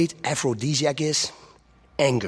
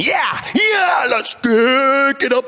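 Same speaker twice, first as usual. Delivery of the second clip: high, loud radio host opener; hyped shout; 9 semitones higher, 3 dB louder than usual. Acted. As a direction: pump up the crowd. Host opens show shouting.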